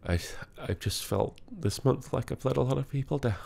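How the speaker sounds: barely holding back sobs; crying. Real, not acted. Vlogger confessing he let people down this month, crying. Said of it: "the thing that I'm crying about".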